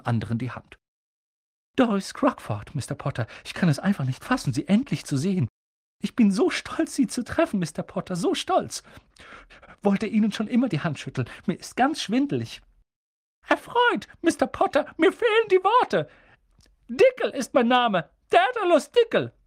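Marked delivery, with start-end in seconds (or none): 1.8-12.6 put-on much higher, gushing, breathless old lady fan; an excited old lady who can't believe her luck; aufgeregte Doris Crockford. Doris schwärmt von Harry. 13.5-19.3 very high and loud, flustered little man; aufgeregter Dickel. Acted. Dickel stellt sich aufgeregt vor.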